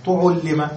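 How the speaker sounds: تعلم as tu'ullima, two short u vowels first; passive form. Shown as a demonstration. Teacher repeating the rule, تعلم said tu'ullima. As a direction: say تعلم as tu'ullima, i after doubled l. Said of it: "تضم الأول".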